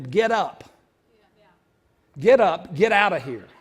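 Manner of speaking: loud, forceful "get up" command; commanding shout. Acted. Jesus ordering the man off the mat, loud command. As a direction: order him up and out.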